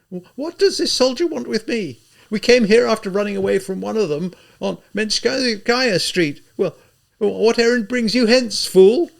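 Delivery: high, loud, overwrought lady's voice; a ruined gentlewoman clinging to her dignity, frantic; feverish proud widow. A narrator's reading. Katerina scorning the soldier.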